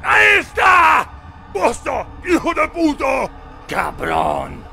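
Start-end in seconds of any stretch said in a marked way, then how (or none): 0.0-3.3 high, loud furious yelling; angry shout. Acted. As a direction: roar at the intruder. Villagers hunting an intruder.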